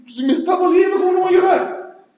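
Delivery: high, loud protesting wail; child crying out. Retold or imitated. Beaten child protesting to the parent, high and loud. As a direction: cry out like a hurt child who can't help crying. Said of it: "cuando te pegaban".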